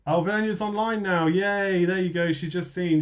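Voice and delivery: high, delighted cheer; excited. Real, not acted. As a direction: celebrate the winner happily. Delighted the winner is watching.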